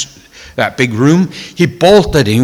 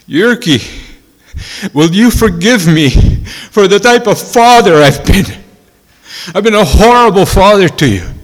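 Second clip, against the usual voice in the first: raised, loud anguished plea; tearful plea; 7 semitones higher, 4 dB louder than usual. Retold or imitated. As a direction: beg forgiveness like a weeping father. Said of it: "just crying hysterically".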